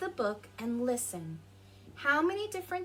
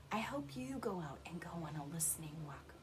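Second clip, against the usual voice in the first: soft whispered farewell; 5 semitones lower, 9 dB quieter than usual. Real, not acted. Closing the story for young listeners, whispered. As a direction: hush it like a secret wish.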